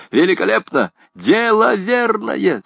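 high, self-applauding triumph; delighted exclamation. A narrator's reading. Gringoire applauds his own idea, exclaiming in delight. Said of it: "восклицая".

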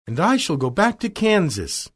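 high, eager exclamation; Dorothy crying out. Acted. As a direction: cry it out as a hopeful story heroine. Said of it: "cried Dorothy".

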